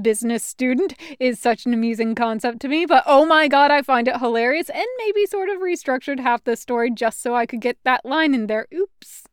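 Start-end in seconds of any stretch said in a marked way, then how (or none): none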